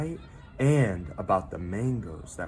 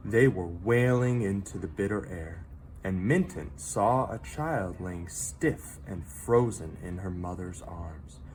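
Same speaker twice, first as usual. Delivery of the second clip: ordinary delivery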